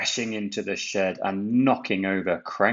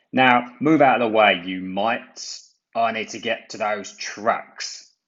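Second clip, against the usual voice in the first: a lightly voiced bossy crane, louder; rude Cranky; 3 semitones higher, 5 dB louder than usual. A narrator's reading. Crane ordering small engines off track, bossy. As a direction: shoo the little engines away. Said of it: "Cranky said".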